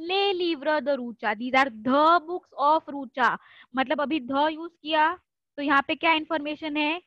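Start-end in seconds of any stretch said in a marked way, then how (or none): 1.4-3.4 "the" drawn out slowly; stress on the. Shown as a demonstration. Teacher stressing "the" for class. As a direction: lean on "the" in "the books". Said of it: "^the fall".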